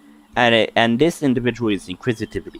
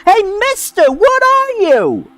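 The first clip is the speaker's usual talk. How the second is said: put-on very high, louder childlike yell; 1 yelling fairy; 5 dB louder than usual. Fairy screaming at the stranger from five feet, very high and loud. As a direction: shriek the question up high at him. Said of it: "you hear it screaming, yelling now".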